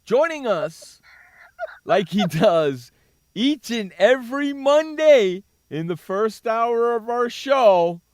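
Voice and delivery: slow, loud radio announcer build-up; booming show intro, stretched words. Acted. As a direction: drag it out like a big intro. Radio host introducing guest.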